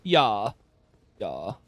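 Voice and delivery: put-on a light German accent in English; hammed-up German speaking English, with some quick lines; playful German accent on English. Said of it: "I can do a German accent speaking English".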